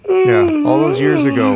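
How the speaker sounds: high, loud, wistful put-on voice; mock lovelorn girl voice. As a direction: ham up a lovesick ex. Mock voicemail from old girlfriend.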